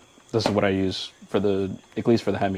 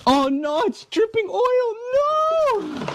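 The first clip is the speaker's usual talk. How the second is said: very high panicked shriek; much slower than usual. Real, not acted. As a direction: shriek in alarm as oil drips. Oil dripping onto him.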